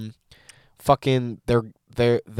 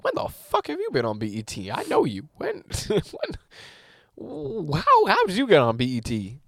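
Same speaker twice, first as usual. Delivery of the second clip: incredulous; 6 semitones higher than usual. Retold or imitated. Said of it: "I was like".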